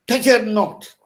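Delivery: Touch her not, each word loud and high; stressed reading. Shown as a demonstration. Teacher demonstrating dactyl line to students, every word stressed. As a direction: hit every word hard. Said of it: "Accented syllables followed by two unaccented".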